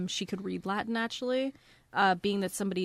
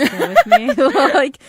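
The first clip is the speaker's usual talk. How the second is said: laughing through the words; loud laughing aside; 5 semitones higher, 16 dB louder than usual. Real, not acted. Laughing at own far-fetched theory.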